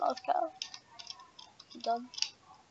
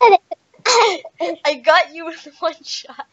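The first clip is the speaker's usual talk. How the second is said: slow crying gloat; 7 semitones higher, 10 dB louder, much slower than usual. Real, not acted. Gloating repeat.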